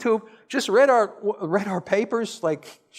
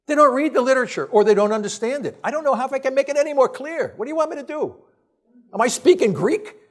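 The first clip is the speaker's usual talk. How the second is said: raised, exasperated lecture voice; exasperated rant; 6 semitones higher than usual. Real, not acted. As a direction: vent at the doctors who won't read the data. Ranting at doctors ignoring data.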